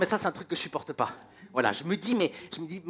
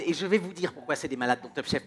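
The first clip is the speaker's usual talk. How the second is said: ordinary talk, nothing marked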